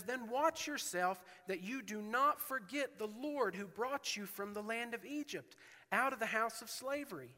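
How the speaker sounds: preacher reading Deuteronomy aloud; scripture reading. Acted. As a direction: read Moses' warning with urgency. Quotes Moses' warning.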